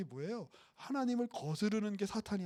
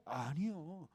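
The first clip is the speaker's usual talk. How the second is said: ordinary talk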